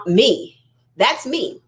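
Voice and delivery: ordinary talk, nothing marked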